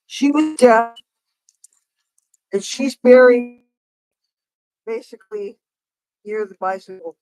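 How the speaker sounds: normal talking voice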